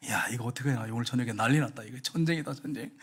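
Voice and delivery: low, soft muttering to oneself; worried self-talk. Retold or imitated. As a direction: mutter in dread of tonight's fight. Mother dreads a fight, muttering. (낮게 걱정하는 혼잣말)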